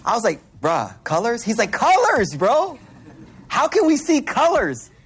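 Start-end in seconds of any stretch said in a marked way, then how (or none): 0.6-1.4 unimpressed, questioning rise on "colors"; retold puzzled reply, questioning "colors"; skeptical question. His own puzzled reply to friend. 1.7-4.9 high-pitched insistence, "colors" swooping down; retold wide-eyed wonder, pitched high; imitated amazement.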